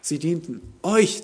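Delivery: slow build up to a stressed "euch"; "euch" drawn out as the key word. Shown as a demonstration. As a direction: lean hard on "euch", stretching the phrase. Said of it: "Petrus betont".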